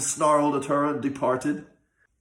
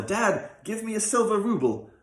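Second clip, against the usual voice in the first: put-on higher, earnest young fellow pleading; a youth begging the old man to stop; young man's voice; 8 semitones higher than usual. A narrator's reading. Young man urging the rich man to stop beating, earnest. Son asks father.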